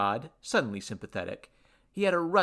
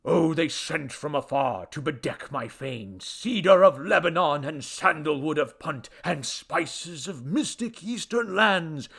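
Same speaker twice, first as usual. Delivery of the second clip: loud, grandiose recital, building; self-absorbed deity orating; droning boastful god; 5 semitones higher, 7 dB louder than usual. A narrator's reading. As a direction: drone on pompously, relishing each treasure. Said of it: "who droned on".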